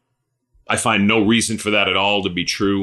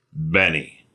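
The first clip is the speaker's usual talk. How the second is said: tender calling; 6 semitones lower than usual. Acted. Jesus calling the listener by name, low and tender. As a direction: call the name gently.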